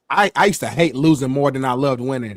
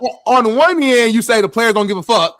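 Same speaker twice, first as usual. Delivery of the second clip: heated argument; 8 semitones higher, 5 dB louder than usual. Real, not acted. Co-host arguing back heatedly.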